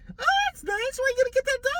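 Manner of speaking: very high, fast put-on voice; imagined Bernice reply, very high mock voice; mock cheerful lady. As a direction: gush it in a squeaky voice. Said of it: "imagined Bernice reply".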